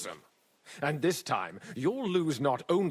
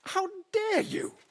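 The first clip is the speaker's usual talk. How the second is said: stammering, then a much higher indignant cry; outraged disbelief. Acted. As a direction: sputter in shocked offence. Mint master hears the accusation.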